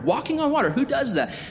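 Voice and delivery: high-pitched disbelief at a wonder; astonished, pitched far above normal; incredulous amazement. Retold or imitated. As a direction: marvel at it, voice leaping way up. Repeats it, amazed at the feat.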